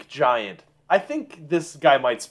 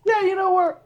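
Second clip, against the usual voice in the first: much higher, overly polite voice; polite giant speech. Acted. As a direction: play an eager host making small talk. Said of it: "This is in giant".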